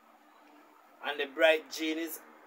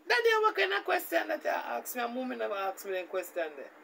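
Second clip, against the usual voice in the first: indignant daughter; 7 semitones higher than usual. Retold or imitated. Daughter to father about his question, high offended falsetto. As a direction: squeal it like a shocked daughter.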